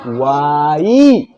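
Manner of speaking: वाई stretched very long, a long ई at the end. Shown as a demonstration. Teacher demonstrating a long vowel to pupils. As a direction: draw out वाई slowly. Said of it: "दीर्घई है".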